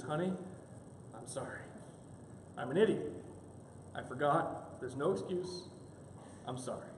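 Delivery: low, soft, halting apology; small-voiced, with pauses between pleas; mock penitent. Husband apologizing to his wife, meekly penitent. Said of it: "penitential tone you can imagine".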